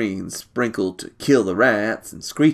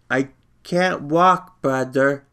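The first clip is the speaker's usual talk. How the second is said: put-on high, slow child's reply; a frail little boy, matter-of-fact; small child voice; 3 semitones higher, slower than usual.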